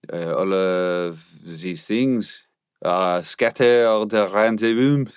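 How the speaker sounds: put-on drunk French cop voice; slurred, slow French accent. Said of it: "be a French inebriated police officer".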